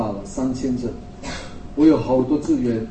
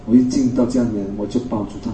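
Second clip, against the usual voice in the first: ordinary delivery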